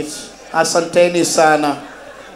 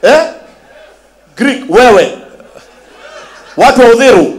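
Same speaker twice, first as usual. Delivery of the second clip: loud, high, laughing shouts; laughing at the audience; laughing call-out; 7 semitones higher, 8 dB louder than usual. Real, not acted. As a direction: tease the crowd through laughter. Host laughing, calling to crowd.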